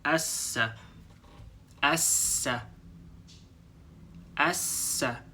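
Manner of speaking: "assa" with the S held long; "assa": linger on the double S. Shown as a demonstration. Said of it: "hold on this two S sound a little bit longer".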